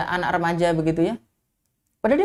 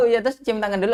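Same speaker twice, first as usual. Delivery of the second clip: normal talking voice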